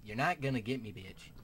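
ordinary talk, nothing marked

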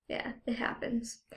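hushed aside to a friend off camera; whispered aside. Real, not acted. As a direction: drop to a whisper for a quick aside.

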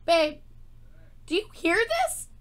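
high-pitched call to a partner; excited calling out. Real, not acted. Fan calling babe to hear this, excited calling out.

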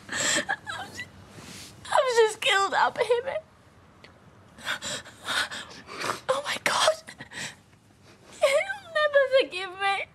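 high, wailing sobs; sobbing despair. Acted. Woman wailing that she has killed the baby. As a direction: break down in anguish.

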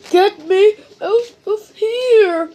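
high, loud plea to be freed; acted whiny storybook plea; begging. A narrator's reading. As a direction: beg loudly for rescue. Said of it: "begged Mister Clumsy".

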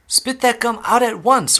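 high, loud command; urgent order. A narrator's reading. Father ordering Violet to drop the gum, loud. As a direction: order it loudly, in alarm. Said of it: "ordered Mister Beauregard".

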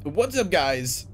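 strange put-on alien greeting. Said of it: "I don't know why I did that voice".

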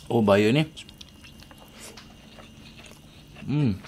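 mumbling between bites; talking while chewing. Real, not acted. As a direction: mouth full of food. Seen 1: Eats rice by hand, bites at 3.6 and 10.9, chews between words.

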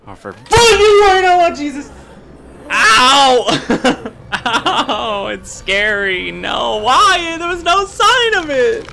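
high, loud shrieks breaking into laughter; real fright, shrill and half laughing; startled screaming. Real, not acted. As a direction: yelp and wail after a jump scare. Jump scare in horror game.

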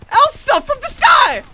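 high, loud, drawn-out shout; shouted battle cry. Real, not acted. Player launching an attack with L-Star, shouted battle cry. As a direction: yell it like an attack announcement.

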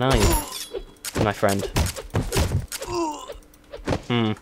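normal talking voice

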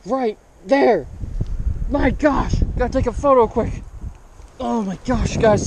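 breathless thrill after landing the fish; giddy, excited rush; freaking out. Real, not acted. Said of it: "I'm freaking out".

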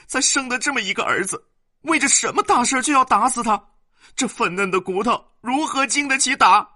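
very high, loud shrieking wife; wailing rant. Acted. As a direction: howl at him, ready to fight. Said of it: "反大哭起来".

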